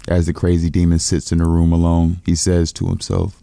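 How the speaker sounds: usual speaking voice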